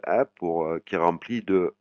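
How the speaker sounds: ordinary talk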